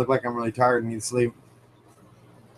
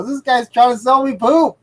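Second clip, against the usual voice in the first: indignant report; 11 semitones higher, 3 dB louder than usual. Retold or imitated. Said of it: "I go".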